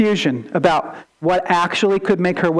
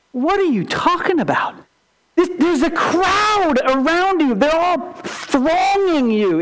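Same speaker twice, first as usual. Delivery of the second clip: 1 exasperated Peter; 6 semitones higher than usual. Retold or imitated. Peter protests the crowd is pressing.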